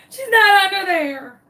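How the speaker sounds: crying out, high and loud, voice breaking; sobbing. Retold or imitated. Owner giving up on her lost chicken, crying. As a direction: wail it through tears of defeat. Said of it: "still sobbing".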